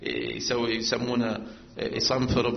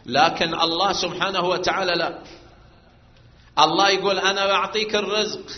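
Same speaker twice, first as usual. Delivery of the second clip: raised, louder preacher's voice; preaching at full force; 6 semitones higher, 8 dB louder than usual. Acted. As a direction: drive the point home to the congregation. Preacher voicing God's promise.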